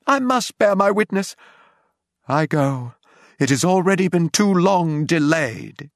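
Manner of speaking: high, declaimed cry of a zealot; frenzied proclamation. A narrator's reading. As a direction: declare it like a ranting prophet. Curate's frenzied preaching before being struck.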